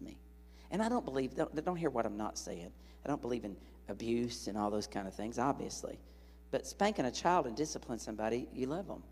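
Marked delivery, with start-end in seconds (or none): none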